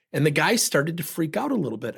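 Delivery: normal talking voice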